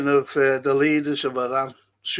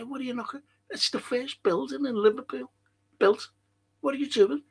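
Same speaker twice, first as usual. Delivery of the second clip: mock protesting preservationist; 10 semitones higher, 6 dB quieter, faster than usual.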